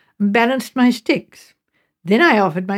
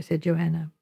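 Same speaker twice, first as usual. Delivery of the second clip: usual speaking voice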